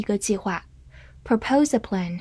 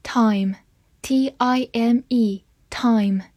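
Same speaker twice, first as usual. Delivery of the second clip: slow spelling; much slower than usual. Shown as a demonstration. Spelling drill.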